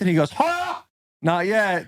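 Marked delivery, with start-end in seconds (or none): 0.3-0.8 high-pitched "huh" as a mock laugh; single high mock guffaw; imitated laugh. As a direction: imitate his laugh in one high burst. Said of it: "that's my depiction of his laugh".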